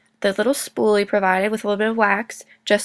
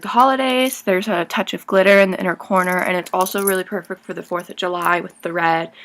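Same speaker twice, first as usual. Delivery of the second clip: ordinary talk, nothing marked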